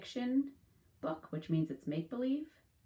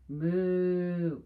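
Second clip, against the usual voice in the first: a loud, drawn-out mock moo; playful cow lowing; 7 dB louder than usual. Cows typing refrain.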